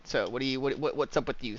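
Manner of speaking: usual speaking voice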